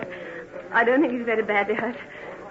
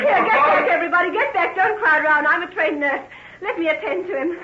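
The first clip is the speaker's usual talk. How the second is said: commanding crowd; 6 semitones higher, 3 dB louder than usual. Acted. Pushing back the crowd.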